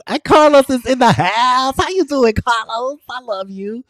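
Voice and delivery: light up like a friend just walked in; excited greeting. Real, not acted. Greeting Carlos as he joins her livestream, thrilled and loud.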